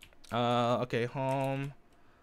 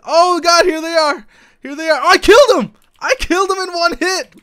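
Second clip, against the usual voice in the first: much higher and louder, shocked and thrilled; startled shout; 15 dB louder than usual. Real, not acted. Raider surprised by the attackers then killing one, excited shout. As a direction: yell at the sudden fight and the kill. Enemies arrive, kills one.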